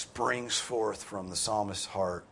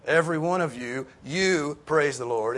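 "Every one of you" loud and high, "you" stretched; loud, raised charge with weight on "you"; very strong command; 4 semitones higher, 6 dB louder than usual. Shown as a demonstration. Preacher commanding every listener to praise, very strong stress on "you". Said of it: "It's a very strong word".

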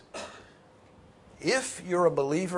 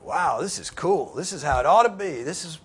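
high, loud burst of enthusiasm; acted-out excited reader, loud and raised; pumped up; 6 semitones higher, 8 dB louder than usual. Reader of the psalm getting inspired, pumped up. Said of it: "it kinda gets you pumped up".

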